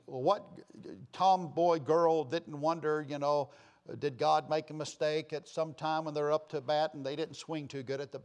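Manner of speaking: normal talking voice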